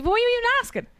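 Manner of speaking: very high-pitched, incredulous question; exasperated. Retold or imitated. Kildare woman replaying chats with clueless Americans, exasperated. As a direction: throw it up high in mock exasperation.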